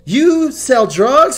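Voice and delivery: very high, slow, stunned question; wife, shocked and squeaking high. Retold or imitated. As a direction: gasp it out in disbelief. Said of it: "she's all like".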